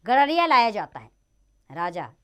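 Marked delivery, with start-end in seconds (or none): none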